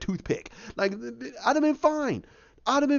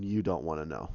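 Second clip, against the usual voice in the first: much lower and softer, trailing down; ominous deadpan; 12 semitones lower, 7 dB quieter than usual. Acted. Teammates warn Carol about the Hulk, low ominous deadpan. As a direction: drop the voice to a low warning.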